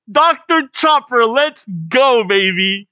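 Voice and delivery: high, loud hype shout; excited cheering. Real, not acted. Fan reacting to Doctor Chopper's big moment, excited cheering.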